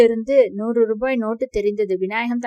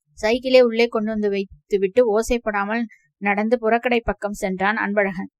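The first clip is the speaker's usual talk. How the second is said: plain reading voice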